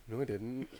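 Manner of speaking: low, soft guilty cat; quiet innocent fib; sheepish denial. Retold or imitated. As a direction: mumble the denial like a caught pet. Cat denying, voiced.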